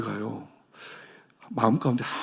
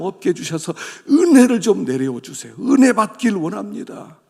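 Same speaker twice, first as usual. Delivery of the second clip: higher, louder pleading cry; fervent crying prayer; 4 semitones higher, 4 dB louder than usual. Shown as a demonstration. 목사가 부흥을 구하는 기도를 보여 주며, 높고 큰 간절한 외침. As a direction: beg God for grace with everything. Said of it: "간절히 기도하는".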